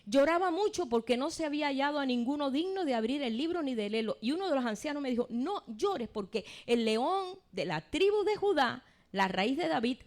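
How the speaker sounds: loud, high scripture reading to the church; preaching at full force. Acted. Preacher reading Revelation aloud to the congregation, loud and rising. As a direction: proclaim it like a sermon climax.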